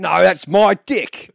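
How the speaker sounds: drawn-out, hammed-up Aussie protest; mock Aussie retort. Podcaster playing the husband defending his banana hammock, loud Aussie accent. Acting out Brock defending himself.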